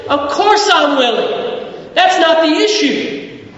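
high, slow, emphatic reply; mock exasperated God. God answering people who doubt He is willing, acted exasperation. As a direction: stretch it out as an impatient assurance.

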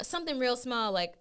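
ordinary talk, nothing marked

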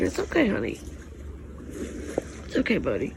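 soft, low, hushed reassurance; calm a frightened pup gently; quiet murmur to a scared dog; soothing. Real, not acted.

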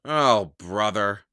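low exasperated grumble; weary, fed-up mutter. A narrator's reading. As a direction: grumble it to yourself. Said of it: "Jack muttered".

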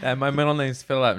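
normal talking voice

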